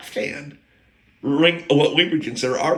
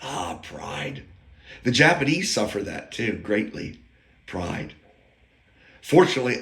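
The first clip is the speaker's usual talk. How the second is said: normal talking voice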